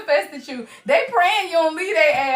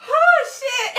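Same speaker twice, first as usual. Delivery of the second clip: high oh shit collapsing into laughter; laughing shriek; 11 semitones higher than usual. Real, not acted. Another card with the drawers line, high laughing shriek. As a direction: let it burst out high and helpless.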